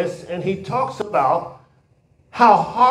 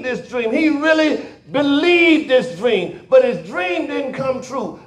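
preacher driving the lesson home to the congregation; sermon preaching; 4 semitones higher than usual. Acted. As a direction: lean on each phrase like a preacher. Preacher builds the sermon's point.

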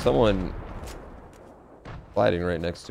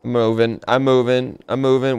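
louder, higher, urgent; 4 semitones higher, 7 dB louder than usual. Real, not acted. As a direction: call it out in a hurry. Urgent repeated while fleeing.

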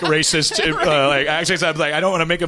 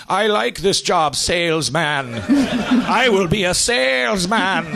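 higher, drawn-out proud resolve; eager declaration; 6 semitones higher, slower than usual. Acted. Man discovers the salesman's job, declaring it eagerly. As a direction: announce a new calling with slow, naive zeal. Newly revived man finds his calling.

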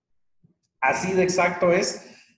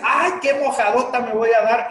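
high, put-on moan about getting soaked; dreading the rain; 7 semitones higher, 3 dB louder than usual. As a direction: whine it up high at the rainy doorway. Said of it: "abre la puerta y dice".

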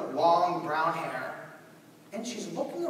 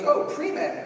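mimicking Stan; 10 semitones higher, 6 dB louder than usual. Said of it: "And he goes".

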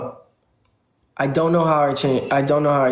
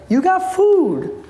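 pet talk; 13 semitones higher, much slower than usual. Real, not acted. Streamer telling her meowing cat it has food, slow high coo.

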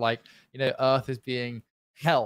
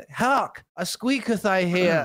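raised, slowing theatrical proclamation; mock olden villager; 4 semitones higher, 3 dB louder, slower than usual. Host playing a villager hearing squeaks, mock-archaic alarm. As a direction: proclaim it like a hammy period villager.

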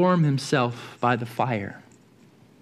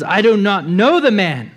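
angry denial; 5 semitones higher, 6 dB louder than usual. Acted. Peter denies with curses.

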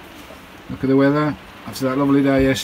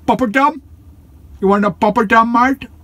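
much higher, playful sing-song to the dog; high teasing dog voice; 11 semitones higher, 4 dB louder than usual. Real, not acted. Owner teasing his dog Skye with a poppadom, high playful voice. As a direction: dangle the treat in a high, teasing voice.

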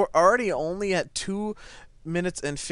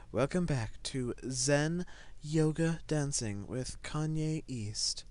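soft, slow, low pretend yoga intro; mock zen host; 3 semitones lower, 9 dB quieter, slower than usual. Gamer greeting viewers to Zen Yoga, mock-serene. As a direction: play a hushed yoga host, drawing it out.

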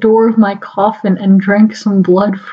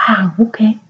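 usual speaking voice

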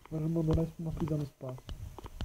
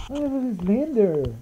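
loud, high, slow drawn-out cry; scared cry at Slender; frightened wail; 6 semitones higher, 10 dB louder, much slower than usual. Real, not acted. Scared exclamation.

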